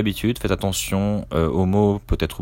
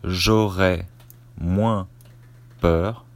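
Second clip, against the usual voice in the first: dictating. Shown as a demonstration. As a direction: dictate it chunk by chunk, naming the punctuation. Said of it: "Je commence la dictée".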